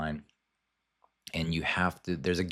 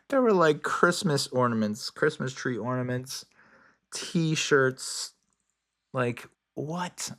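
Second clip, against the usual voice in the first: amazed disbelief; 7 semitones higher, slower than usual. Real, not acted. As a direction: pile up the examples in growing disbelief. Listing absurd six seven merchandise.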